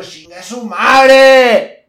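loud, very slow, high curse; mock yell. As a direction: spit it out loud and drawn out.

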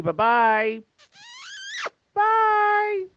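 high sing-song "bye-bye"; singsong goodbye. Real, not acted. Caller signs off to Scotty, high singsong bye.

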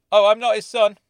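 quick loud blurt; hasty blurted correction. A narrator's reading. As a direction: let it slip out in a rush. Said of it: "I blurted out".

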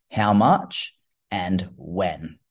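slow right down on "how much and when"; slow emphatic repeat. Shown as a demonstration. Host repeating the episode title for listeners, slowly and deliberately. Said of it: "So I repeat".

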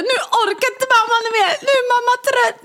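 high, whiny exhausted mom. Retold or imitated. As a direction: whine it like a worn-out mother. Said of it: "jag säger så här". (högt gnälligt trött mamma)

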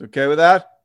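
loud shout. Real, not acted. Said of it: "shout like this".

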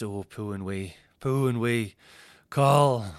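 lightly voiced frail old lady; a hint of a doddery pensioner. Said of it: "as if [name]'s in a rocking chair".

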